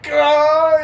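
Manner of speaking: high straining yell; straining cry. Retold or imitated. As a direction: grunt it out like a struggling hero. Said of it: "and he's like".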